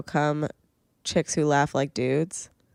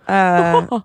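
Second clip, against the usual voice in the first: usual speaking voice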